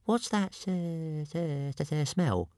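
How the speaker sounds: high, stammering dragon voice; thin high voice stuck on the s; Toothless the dragon. A narrator's reading. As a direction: stutter it in a high creature voice. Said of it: "Toothless wrinkled his snout and sniffed".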